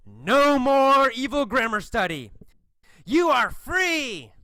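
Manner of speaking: high, triumphant hero's announcement; triumphant proclamation. A narrator's reading. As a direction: proclaim their freedom, stretching the last words. Hero frees the students.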